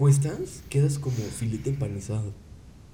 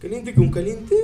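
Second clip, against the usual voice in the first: much higher and louder, incredulous question; mock surprise; 11 semitones higher, 5 dB louder than usual. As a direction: act out disbelief at the idea. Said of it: "Y tú dices". (sorpresa incrédula, mucho más aguda y fuerte)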